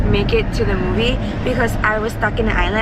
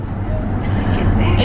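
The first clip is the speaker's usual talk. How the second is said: whispered; much slower than usual. Real, not acted. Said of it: "It's really funny when you say it".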